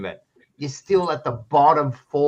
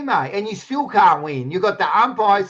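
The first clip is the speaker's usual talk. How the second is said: ordinary talk, nothing marked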